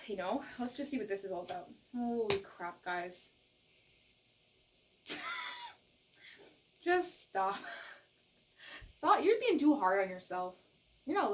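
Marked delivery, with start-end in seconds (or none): 5.1-5.7 high shriek of laughter. Real, not acted. Reader shrieking with laughter at the cards. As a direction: squeal with laughter.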